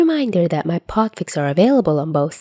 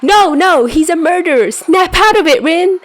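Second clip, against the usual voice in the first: higher and louder self-scolding; scolding herself; 10 semitones higher, 5 dB louder than usual. Acted. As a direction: snap herself out of it.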